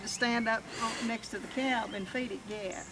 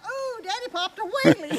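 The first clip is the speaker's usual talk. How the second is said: put-on high, slow, giggling child's voice; delighted little boy; 12 semitones higher, 6 dB louder than usual. Mom imitating her little son, high and giggly with delight. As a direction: squeal it like a tickled little kid.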